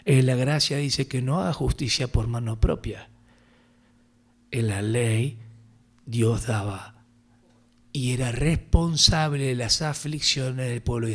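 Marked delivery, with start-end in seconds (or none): none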